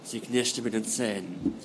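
measured, dramatic reading voice; story narration. A narrator's reading. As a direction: read it like an audiobook. Author reads novel prologue aloud.

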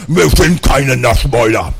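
loud, muffled protest through a mouthful; mouth full. A narrator's reading. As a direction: talk with cheeks crammed with gummy bears. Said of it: "mit vollem Mund meinte".

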